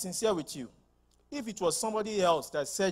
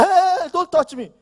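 demons' shout; 10 semitones higher, 10 dB louder than usual. Acted. Demons speak to Christ.

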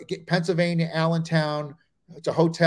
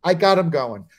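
ordinary talk

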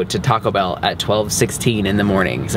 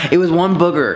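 laughing; 7 semitones higher than usual. Real, not acted. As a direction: laugh it out in embarrassment. Laughing at her own confession.

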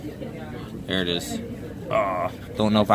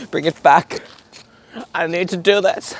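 desperate plea; 8 semitones higher, 3 dB louder than usual. Real, not acted. Pleading for the McFlurry's return.

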